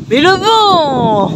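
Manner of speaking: slow, drawn-out complaint at the wind; exasperated cry. Real, not acted. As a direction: groan it out, half laughing. Wind gust interrupts car tour.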